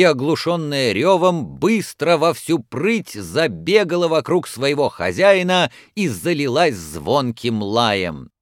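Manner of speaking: ordinary delivery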